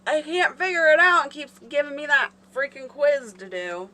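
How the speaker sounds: loud, high frustrated complaint; exasperated. Real, not acted. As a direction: call back in exasperation. Frustrated answer to off-mic helper.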